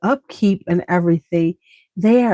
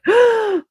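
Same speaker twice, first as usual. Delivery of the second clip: sharp gasp. Real, not acted. Sharp gasp mid-thought.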